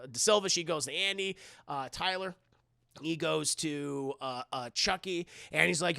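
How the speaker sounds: ordinary talk, nothing marked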